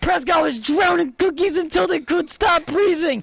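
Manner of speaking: high, slow, strident accusation; mock outrage. Real, not acted. Mocking friends' overreaction to eating Oreos, high mock outrage. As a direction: pile on with exaggerated indignation.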